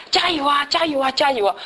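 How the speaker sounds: high, bright cheering from above. Retold or imitated. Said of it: "就说". (提高音调的加油呐喊)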